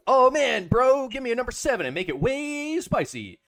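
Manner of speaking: loud, high, hammed-up bro voice. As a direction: overplay an excited bro ordering food.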